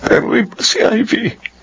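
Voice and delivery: a man close to tears over lost love; emotional confession. Acted.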